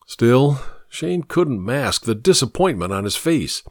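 ordinary delivery

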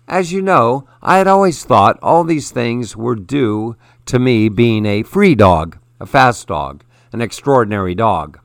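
plain reading voice

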